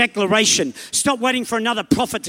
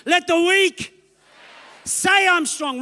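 higher, fuller declaration; stronger declaration; 8 semitones higher, slower than usual. Shown as a demonstration. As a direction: say it like you believe it. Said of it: "that was okay".

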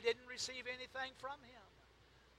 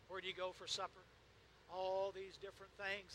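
ordinary talk, nothing marked

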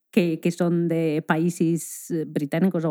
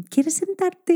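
much higher, sing-song kindergarten teacher voice; teacher voice for kids; 11 semitones higher, slower than usual. Shown as a demonstration. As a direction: talk to adults like to six-year-olds. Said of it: "Y con una voz así, tal cual". (voz aguda de maestra de niños)

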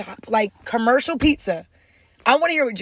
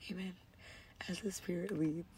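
whisper; 4 semitones lower, 19 dB quieter than usual. Pretend. Mock church language.

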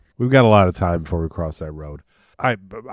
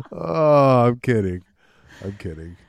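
ordinary talk, nothing marked

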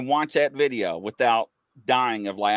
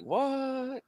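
mock bewildered what; 12 semitones higher, 7 dB quieter than usual. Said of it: "she's like".

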